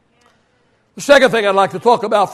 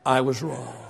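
quiet humble apology; 12 semitones lower, 12 dB quieter than usual. Retold or imitated. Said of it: "and tell them".

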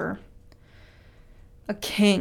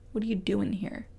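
much softer, near a hush; 10 dB quieter than usual. Shown as a demonstration. Preacher echoes the still small voice, soft. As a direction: ask it gently under the breath. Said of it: "a still small voice".

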